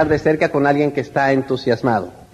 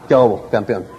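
lower, tough-guy greeting; 9 semitones lower than usual. Retold or imitated. Speaker recalling his younger self acting tough, low greeting. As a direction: try to sound like a hard man. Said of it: "yo quería verme más malo".

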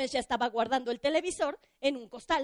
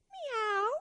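mock meow; 6 semitones higher than usual. The cat meows.

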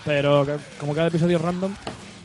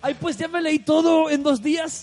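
slow, much higher put-on mocking voice; mocking boast; 9 semitones higher, slower than usual. Mocking his friend's boast about reading it fast, slow higher mock voice. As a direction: drawl it out like a smug show-off.

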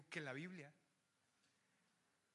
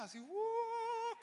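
mock mystic hoot; 5 dB louder, much slower than usual. Mock levitating prophet.

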